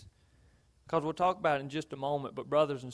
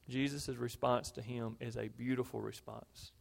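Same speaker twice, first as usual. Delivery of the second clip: ordinary talk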